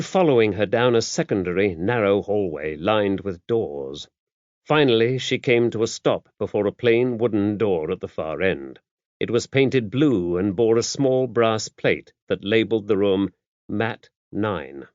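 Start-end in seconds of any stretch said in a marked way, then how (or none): none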